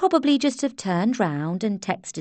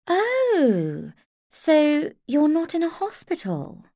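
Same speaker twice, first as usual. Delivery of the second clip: snobbish socialite; 9 semitones higher, slower than usual. A narrator's reading. Snobbish Portia questions nurse.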